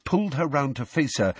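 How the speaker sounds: plain reading voice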